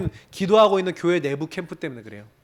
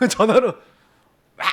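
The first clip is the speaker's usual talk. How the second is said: laughing; 4 semitones higher than usual. Real, not acted. Laughing while recalling shouting.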